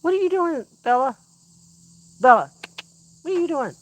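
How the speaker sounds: high, singsong questions to the dog; high pet voice. Real, not acted. Owner asks Bella what she is doing, high singsong pet voice.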